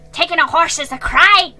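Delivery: angry accusation. A narrator's reading. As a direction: hurl the accusation.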